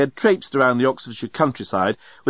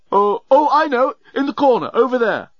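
high, louder burst of discovery; excited realisation; 12 semitones higher, 4 dB louder than usual. A narrator's reading. Leovinus suddenly remembers the hiding place, excited. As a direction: blurt it out as memory returns.

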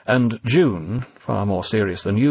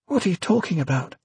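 nervous; 8 semitones higher than usual. A narrator's reading. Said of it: "suddenly nervous".

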